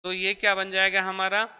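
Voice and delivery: usual speaking voice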